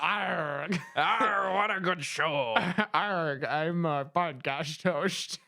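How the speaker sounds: hammed-up pirate growl through laughs; slow pirate bluster, cracking up; laughing pirate voice. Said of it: "You're not doing a pirate accent".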